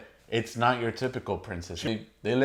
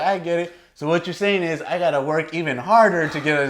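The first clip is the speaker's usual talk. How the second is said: high, louder earnest misreading; proudly miss the point entirely; mock Tiana; 6 semitones higher, 5 dB louder than usual. Tiana misreading the lesson, higher mock-proud voice. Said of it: "Tiana's like".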